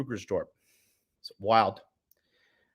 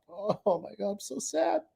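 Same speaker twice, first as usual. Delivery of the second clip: mock crying; 6 semitones higher than usual. Mocking the chatter who called him soy boy, whiny fake sob. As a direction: whimper it like a hurt crybaby, hammed-up. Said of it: "I'm gonna dry my tears".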